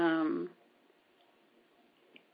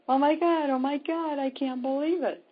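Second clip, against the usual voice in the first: high-pitched astonished re-enactment; amazed assistant; 8 semitones higher than usual. Retold or imitated. Healer imitates her usually calm assistant seeing movement, astonished. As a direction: imitate an assistant's startled cry, pitched well up. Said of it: "my assistant, who is very calm, cool, and collected".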